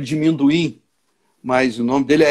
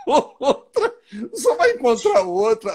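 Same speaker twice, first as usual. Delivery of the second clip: can't hold the laugh through the line; 8 semitones higher than usual. Real, not acted. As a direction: quote breaking into real laughter. Laughs while quoting old lady. (rindo no meio da fala)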